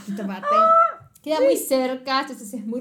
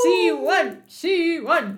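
exasperated groan; 7 semitones higher, 5 dB louder, much slower than usual. Real, not acted. Exasperated at the character.

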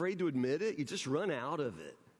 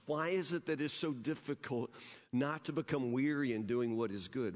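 usual speaking voice

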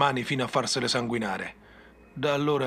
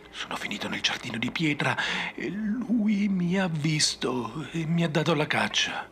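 slightly raised, haunted telling; lightly frightened; 3 semitones higher than usual. Acted. Survivor tells the ranger he was hunted, mildly haunted. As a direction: still shaken, a touch higher. Crazed survivor recounting chase.

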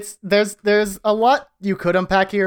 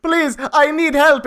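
pleading; 9 semitones higher, slower than usual. Retold or imitated. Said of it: "the guy's like".

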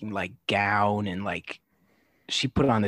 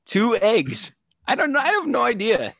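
exasperated; 12 semitones higher, 4 dB louder than usual. Real, not acted. Exasperated about egged car.

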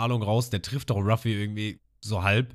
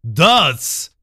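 long, drawn-out, louder battle cry; attack shout; 4 semitones higher, 10 dB louder, much slower than usual. Retold or imitated. Arlong yells his attack name, long and loud. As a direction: stretch every syllable of the attack name. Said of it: "Und er schreit dann".

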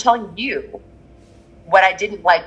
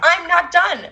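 indignant; 11 semitones higher, much slower than usual. Real, not acted. Indignant at being cut off.

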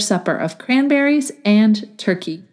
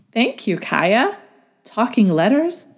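ordinary delivery

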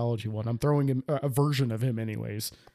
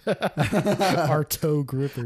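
laughing; 3 semitones higher, much slower than usual. Shown as a demonstration. Repeating the funny phrase, laughing.

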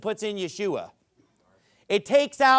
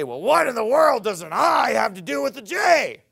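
raised, loud mocking objection; mock objector; 4 dB louder than usual. Preacher voicing a skeptic in the congregation with mock loudness. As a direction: voice the skeptic's challenge loudly. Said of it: "Now you say".

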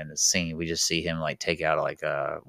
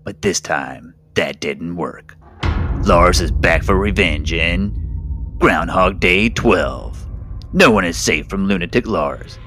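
slow, booming trailer announcer; horror trailer voice; 4 dB louder than usual. Acted. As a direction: boom it like a movie trailer. Parody movie trailer over music.

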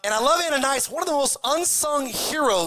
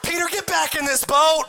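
yelling crowd; 5 semitones higher than usual. Retold or imitated. Disciples in the boat yelling at Peter to come back. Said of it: "Everybody's in the boat going".

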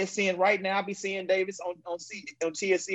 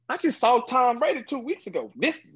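normal talking voice

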